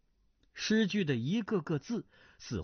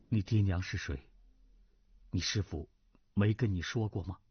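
lower, subdued voice of a middle-aged lord; older nobleman, gentle; 6 semitones lower than usual. Acted. Duan Zhengchun voice. (低沉温和的中年男子)

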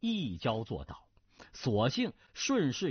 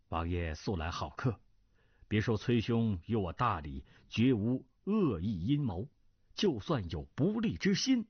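courteous assurance, ending in a low chuckle; genial diplomatic marquis; 6 semitones lower than usual. Acted. Marquis assuring Cui of the prince's tolerance, genial. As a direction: reassure politely with a veiled point.

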